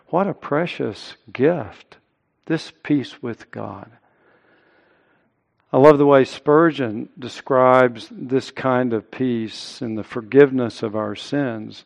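normal talking voice

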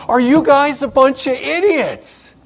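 hammed-up frustrated teacher scolding his students; exasperated outburst. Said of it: "he might have looked at them and said".

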